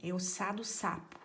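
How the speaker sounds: ordinary talk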